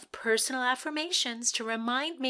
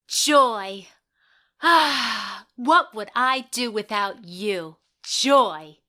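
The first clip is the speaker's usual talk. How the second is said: louder, overdone dramatic read; audition reading again; 8 dB louder, much slower than usual. Acted. Actress repeats her line for the panel, louder, hammed up. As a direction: give the line one more big dramatic try. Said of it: "One more time myself?".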